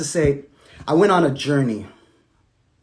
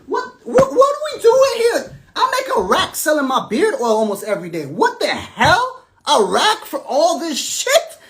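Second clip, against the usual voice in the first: high, incredulous mock voice; mock outraged self; 12 semitones higher, 4 dB louder than usual. Retelling his shock at the pay, high mock outrage. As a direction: play your past self, stunned and high. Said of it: "I was like".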